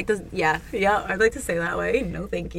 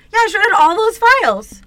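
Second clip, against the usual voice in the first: much higher, louder cheery voice; cheery brain worker; 12 semitones higher, 7 dB louder than usual. Pretend. The brain worker cheerfully admits shredding every file. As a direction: chirp it out proudly, no clue. Voicing characters inside the brain.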